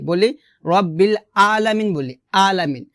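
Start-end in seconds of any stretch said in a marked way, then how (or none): 0.6-1.9 আলামিন starting on a plain open আ; রব্বিল আলামিন with a glottal, everyday a; hamza instead of ayn. Shown as a demonstration. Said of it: "আমরা হামজা উচ্চারণ করি". 2.3-2.9 আলামিন starting on a plain open আ; রব্বিল আলামিন with a glottal, everyday a; hamza instead of ayn. Shown as a demonstration. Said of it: "আলামিন বলা যাবে না".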